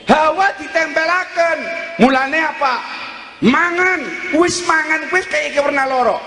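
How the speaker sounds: high-pitched, forceful sermon delivery; preaching. Real, not acted. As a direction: drive each short phrase home like a preacher.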